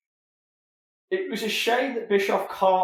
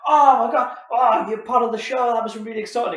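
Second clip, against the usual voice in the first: mock fan excitement; 6 semitones higher, 5 dB louder, faster than usual. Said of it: "Everyone else would have gone".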